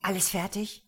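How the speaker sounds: ordinary delivery, nothing marked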